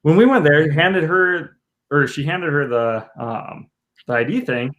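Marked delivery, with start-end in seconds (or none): none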